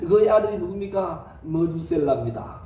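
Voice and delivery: ordinary delivery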